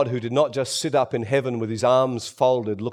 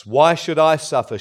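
ordinary talk, nothing marked

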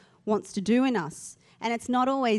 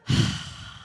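breathy whoosh of relief; sigh of relief. Shown as a demonstration. Diner loosening belt after Christmas lunch, relieved sigh. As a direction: exhale long as if loosening a belt. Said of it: "you kinda go".

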